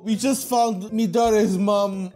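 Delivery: ordinary delivery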